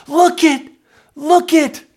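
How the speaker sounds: high, loud shout of wonder; excited yell. Acted. Pastor yelling about changed lives, excited shout. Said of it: "I just want to yell".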